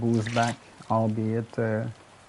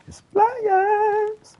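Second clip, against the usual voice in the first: "Is playas" sung way up in falsetto, louder; falsetto echo; 5 dB louder than usual. Pretend. Singing to himself while gaming, falsetto echo.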